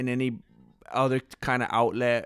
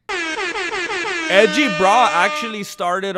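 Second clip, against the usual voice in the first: talking through a burp; words broken by a burp; 8 semitones higher, 6 dB louder, much slower than usual. Real, not acted. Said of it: "oh my gosh, what's wrong with me".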